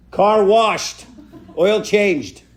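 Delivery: loud, high mock yells; shouted demands, laughing; barked orders. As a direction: fire off demands through the door. Kid barking orders through door.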